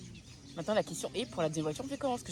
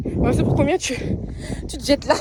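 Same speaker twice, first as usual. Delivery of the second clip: excited; 8 semitones higher, 5 dB louder than usual. Real, not acted. Teasing friend about to jump.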